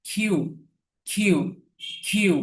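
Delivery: "কিউ" begun with a puffed kh; aspirated letter Q. Shown as a demonstration. Teacher drills Q for learners, aspirated kh. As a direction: say "কিউ" as khiu, with air. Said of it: "খয়ের মতো উচ্চারণ করব".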